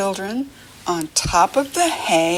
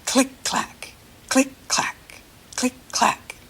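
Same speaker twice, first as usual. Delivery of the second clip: soft breathy clicks, a bit higher; whispered click clack; 5 semitones higher, 4 dB quieter than usual. Shown as a demonstration. Teacher repeating the wheel sound for kids to try, whispered. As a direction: tap it out softly with your mouth. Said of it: "Try that with your mouth".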